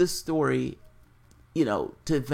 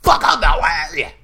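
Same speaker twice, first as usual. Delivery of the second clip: imitating a furious yell; 10 dB louder than usual. Quoting a streamer's rant, loud mock yell. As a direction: yell it like a man flipping out. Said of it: "he's like".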